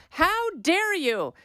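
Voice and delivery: high, slow, hammed-up "How dare you?"; mocking outrage. Host mocking a party talking point with exaggerated outrage. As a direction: stretch "How dare you?" way up in mock indignation. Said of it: "And they go".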